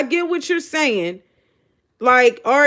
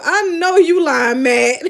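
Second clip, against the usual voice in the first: raised voice breaking into a real laugh; laughing disbelief; 2 semitones higher, 3 dB louder than usual. Real, not acted. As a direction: call out the lie while cracking up.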